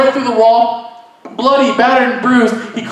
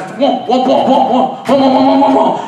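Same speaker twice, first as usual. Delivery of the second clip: whomps getting louder and faster; louder whomp sound effect; 3 semitones higher, 3 dB louder than usual. Retold or imitated. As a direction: build the thudding coffin sound. Coffin sound, building louder.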